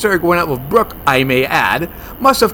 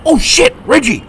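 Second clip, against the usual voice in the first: alarmed; 10 semitones higher than usual. Acted. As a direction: jolt of shock. Sees partner in danger.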